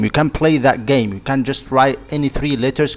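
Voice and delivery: ordinary talk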